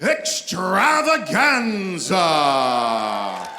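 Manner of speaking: booming fight-night ring announcer; lucha libre announcer. Real, not acted. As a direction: roll it out big like a cage fight intro. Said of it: "Sounds like he belongs in the U F C ring".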